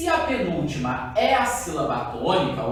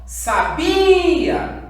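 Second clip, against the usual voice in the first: "sabia" em chamado alto e agudo, BI muito arrastado; chamando a palavra; 11 semitones higher, 7 dB louder than usual. Shown as a demonstration. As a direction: solte "sabia!" esticando bem o BI. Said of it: "chamar essa palavra pra descobrir a sílaba tônica". ("sabia!" chamado devagar, força toda no BI)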